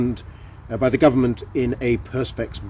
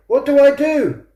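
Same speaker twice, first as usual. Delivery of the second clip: panicked question; 13 semitones higher than usual. Acted. Caller panicking about visitor.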